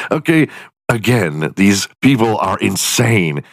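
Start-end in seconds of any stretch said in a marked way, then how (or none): none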